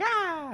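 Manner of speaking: high-pitched "ah" squeal; little girl's cry. Acted. Reader imitating a little girl, high squeal. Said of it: "this little girl's like".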